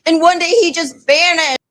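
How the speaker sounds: high-voiced ex telling her sob story; put-on high girl voice; imitated caller. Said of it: "And she's like".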